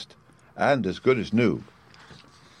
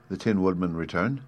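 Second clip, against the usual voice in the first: ordinary delivery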